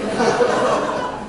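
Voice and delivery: breathy burst of real laughter; amused laugh. Real, not acted. Preacher laughing at his own story, amused.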